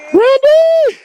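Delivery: loud shout to a friend; calling out. Real, not acted. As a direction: yell his name across the trail.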